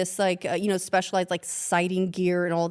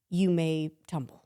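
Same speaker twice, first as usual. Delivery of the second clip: low, slow and soft pronouncement; solemn mock decree; 5 semitones lower, 5 dB quieter than usual. Cheese board addressing gymnastics, slow and solemn. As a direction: grant permission gravely and slowly. Voicing cheese authority granting permission.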